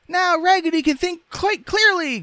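loud, extremely high squeaky cry; dolls crying out. A narrator's reading. Dolls rejoicing for their friend, crying out. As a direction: shout it in doll falsetto. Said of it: "cried out the dolls".

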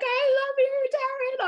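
high squeaky love confession; mock tearful declaration. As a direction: overcome with emotion, squeak it out. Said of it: "he's like".

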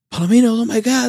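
high-pitched mock fan squeal; excited fan cry. Fan spotting the band at the airport, excited.

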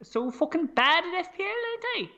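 mock Welsh, swooping high on the tag question; exaggerated amateur Welsh; Welsh accent. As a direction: put on a lilting Welsh accent. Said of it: "a Welsh accent, if you would".

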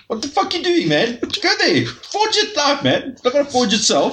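very high, squeaky mock shouting; bobblehead Niels voice. Pitching a bobblehead toy, squeaky mock Niels. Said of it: "bobbleheads where you press a button".

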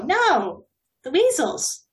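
usual speaking voice

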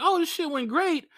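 very high, soft naive cheer; naive happy self. Retold or imitated. Comic recalling thinking the gig went great, high naive cheer. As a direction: sound blissfully unaware. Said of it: "I thought in my head".

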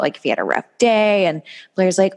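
ordinary talk

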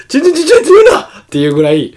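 high, loud laugh-through words. Real, not acted. Laughing as he tells his friend about the teasing. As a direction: laugh through the complaint, barely getting it out. Laughing over own embarrassing story.

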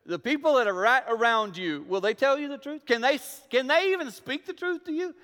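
high, loud, pressing questions; preaching at full force. Acted. Pastor pushing his congregation, rising preacher voice.

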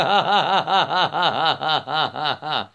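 long rattling laugh. Real, not acted. Man delighted by his own chanting, laughing at length. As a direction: laugh in a long, rapid run of ha-has.

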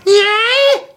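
high cat yell of yeah; cat yowl imitation. Imitating his cat demanding attention, high yowl. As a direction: mimic a demanding cat's yowl. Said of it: "Dax comes up and goes".

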